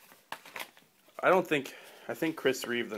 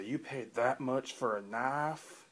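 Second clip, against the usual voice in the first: slow, drawn-out Southern twang; a lightly done Southern drawl; Southern accent; 3 semitones higher, slower than usual. Retold or imitated. Shocked friend asking the price in a Southern accent. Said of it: "that's the accent they use".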